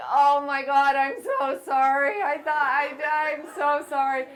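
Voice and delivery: loud, high, slowed gush of sorry; mortified, over-the-top apologizing; imitating her own panicked apology; flustered apology. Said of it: "and I'm like".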